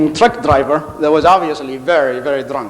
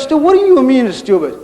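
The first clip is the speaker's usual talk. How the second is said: higher, louder outraged question; can't believe what he heard; indignant disbelief; 7 semitones higher, 3 dB louder than usual. Retold or imitated. Friend outraged at being called stupid, higher and louder.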